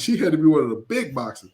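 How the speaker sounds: normal talking voice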